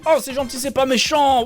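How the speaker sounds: imitation moqueuse. Se moque des mots de S1.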